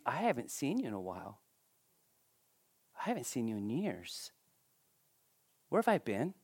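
soft, hushed, amazed words; tender hushed wonder. Retold or imitated. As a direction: speak softly as if to a lost loved one. Tender words to wife in dream.

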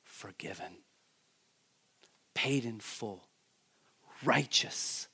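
very soft, low and tender; gentle voice of God. Retold or imitated. The Lord speaking softly to the humbled worshipper. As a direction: let each word fall softly. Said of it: "And he'll say".

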